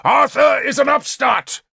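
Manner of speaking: high, scornful shout; angry shout. A narrator's reading. King denouncing Arthur to his hall, shouted. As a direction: cry it out to the crowd. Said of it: "Gorfethid shouted".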